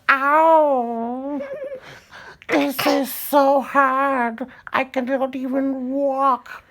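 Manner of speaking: high, loud wailing witch in mock pain; hurt witch. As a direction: howl like a witch just whacked with a hammer. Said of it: "And she's like".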